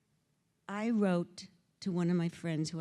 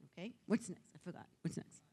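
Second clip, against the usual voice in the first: usual speaking voice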